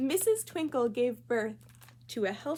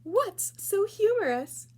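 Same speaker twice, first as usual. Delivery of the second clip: higher, louder and slow, a bright music teacher; teacher's question; 11 semitones higher, 6 dB louder, slower than usual. Acted. Music teacher asking giggling students, high slow voice. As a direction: sing it out like a dreamy teacher.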